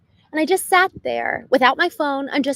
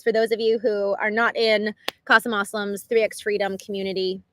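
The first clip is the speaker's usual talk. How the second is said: ordinary talk, nothing marked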